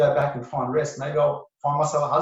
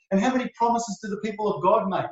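ordinary talk

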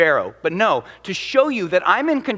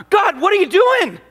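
high, loud exasperated protest; Moses complaining; 13 semitones higher, 5 dB louder than usual. Retold or imitated. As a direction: cry out at God in frustration. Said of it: "in turn, turns to God".

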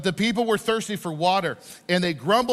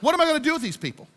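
higher, quick exasperated outburst; God; 7 semitones higher, much faster than usual. Acted. God complaining to Moses about the people, exasperated. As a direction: fire it out, fed up with them. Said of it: "God is gonna talk to Moses".

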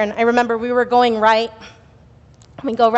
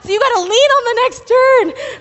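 retold frantic yell; 8 semitones higher, 3 dB louder than usual. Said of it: "And he was like".

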